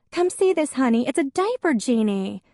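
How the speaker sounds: high, loud call across the house; Faith calling out. A narrator's reading. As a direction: call her husband from the next room. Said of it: "called Faith".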